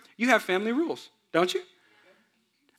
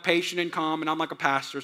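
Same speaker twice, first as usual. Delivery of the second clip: ordinary talk, nothing marked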